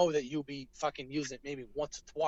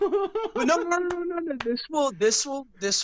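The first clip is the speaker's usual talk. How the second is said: laughing protest; 13 semitones higher, 5 dB louder than usual. Real, not acted. Guest laughing while backpedaling.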